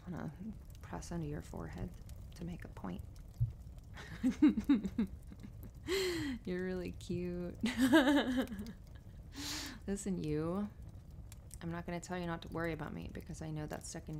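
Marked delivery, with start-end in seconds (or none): 3.0-6.4 higher-pitched giggle trailing off; giggling. Real, not acted. Cozy on partner's lap, making a point, giggling. 7.6-9.8 bright burst of high giggles; giggling. Real, not acted. Telling partner they're really cute, giggling.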